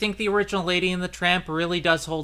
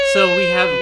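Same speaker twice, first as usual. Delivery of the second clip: excited; 7 dB louder than usual. Real, not acted. Excited podcast intro.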